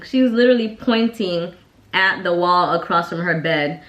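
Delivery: normal talking voice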